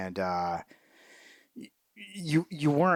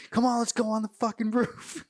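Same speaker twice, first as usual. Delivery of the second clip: high-pitched mock yell; imitated yelling; 12 semitones higher than usual. Colonel rushing the men up onto the roof, mock yelling. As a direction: urge them on in a high, cartoonish cry. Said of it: "he's yelling about Mooj".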